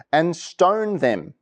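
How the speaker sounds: drawn out very slowly, word by word; slowed for weight. Acted. Pastor slows right down reading the stoning, dead slow. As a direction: let each word land slowly. Reading the plot to kill them.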